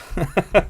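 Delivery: high chuckle; amused laugh. Real, not acted. Host cracks up at a viewer's comment, amused laugh. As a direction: burst out in a short, high laugh.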